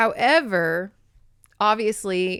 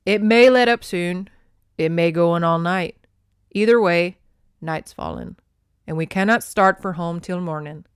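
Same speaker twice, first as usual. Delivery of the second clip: louder Highland man's voice; steady and firm, a rough Scot; Scottish captor; 6 dB louder than usual. Acted. Captor tells captive they're stuck overnight, loud Scottish voice.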